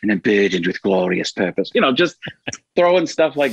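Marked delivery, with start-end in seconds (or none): 0.0-1.7 grand Loki voice; Loki impression. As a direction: proclaim it like the god of mischief. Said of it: "Thor, Loki".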